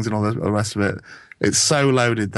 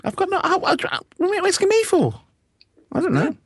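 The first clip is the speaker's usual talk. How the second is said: much higher, flustered protest; high-pitched protest. Real, not acted. Asked who's that, protests.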